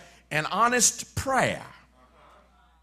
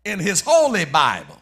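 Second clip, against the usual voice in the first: louder fervent preaching; 4 semitones higher, 5 dB louder than usual. Acted. Preacher citing Jeremiah, Elijah and Moses, fervent preaching. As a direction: press each holy word home.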